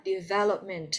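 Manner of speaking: "Development" with stress on the second syllable. Shown as a demonstration. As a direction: say "Development" as de-VEL-opment. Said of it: "Nhấn âm hai nhá".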